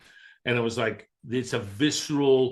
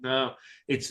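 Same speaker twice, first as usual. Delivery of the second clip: ordinary talk, nothing marked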